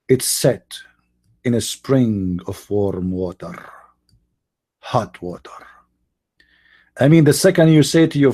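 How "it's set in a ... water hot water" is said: sinking to a slow hushed whisper on hot water; secret whisper. Acted. Man revealing the sunset secret to his fiancée, whispered. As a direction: let her in on a grand secret.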